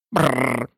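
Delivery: soft rolled shiver noise; shivering brr. A narrator's reading. Lory trembling from cold at the lecture, shivering. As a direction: shiver with a low, trilled brr. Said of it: "здригаючись від холоду".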